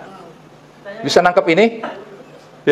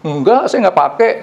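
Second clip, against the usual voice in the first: mild mock yell back, pitched high; retold shouted reply; 8 semitones higher than usual. Helper denying she used the keys, a mild retold yell. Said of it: "saya teriak, dia teriak lagi".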